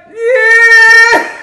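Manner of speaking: high, very loud laughing whimper; mock baby wail. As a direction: imitate a toddler about to cry, cracking up. Baby then starts crying.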